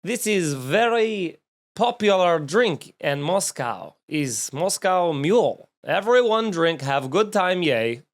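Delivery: hammed-up Russian immigrant sales pitch; bad Russian accent. Host playing a Russian immigrant selling drinks, bad Russian accent. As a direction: do a broken-English Russian seller, badly. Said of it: "a bad Russian accent".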